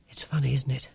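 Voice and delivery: soft, knowing whisper; conspiratorial whisper. Acted. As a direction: share the joke under your breath. Marcia confiding at night.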